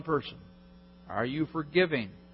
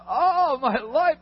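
high, loud jubilant cry; overjoyed servant; 9 semitones higher, 6 dB louder than usual. Acted. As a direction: shout with the joy of a freed man. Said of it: "Can you imagine that guy ... jumping all around".

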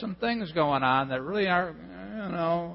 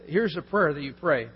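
ordinary talk

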